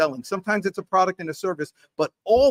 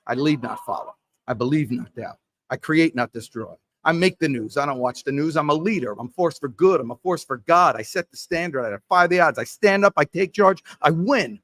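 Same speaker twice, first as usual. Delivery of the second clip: pumped-up mantra; 3 dB louder than usual. Shown as a demonstration. Salesman reciting his self-hype mantra, building to a loud chant. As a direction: recite the mantra like a fired-up salesman getting in the zone. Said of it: "So it sounds like this".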